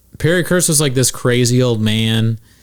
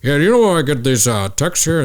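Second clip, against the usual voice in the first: quick, put-on dad voice; dad impression; 4 semitones higher than usual. Dad handing his phone to his son, quick mock dad voice. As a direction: imitate your dad asking, hurried and higher. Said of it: "my dad hands like".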